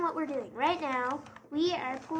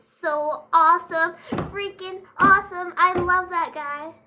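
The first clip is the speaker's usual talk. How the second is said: loud, emphatic fan rave; excited gushing; 3 semitones higher, 10 dB louder than usual. Real, not acted. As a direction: gush loudly, leaning on each word. Gushing over favourite actor.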